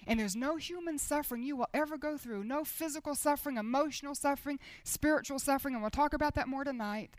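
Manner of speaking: ordinary talk, nothing marked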